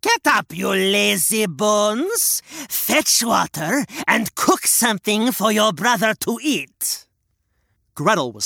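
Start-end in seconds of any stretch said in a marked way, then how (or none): 0.0-7.0 witch shouting. Acted. Witch wakes Gretel, shouting hag. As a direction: yell her out of bed. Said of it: "and shouted".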